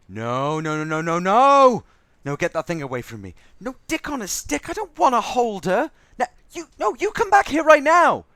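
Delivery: high, loud escalating no's; frantic refusal turning into a shout; panicked shouting. Acted. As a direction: back away in panic and yell after them. Handed the alien baby to hold.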